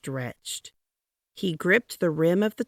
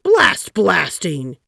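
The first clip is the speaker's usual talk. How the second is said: loud slow curse; 2 semitones higher, 9 dB louder, much slower than usual. A narrator's reading. Taft cursing.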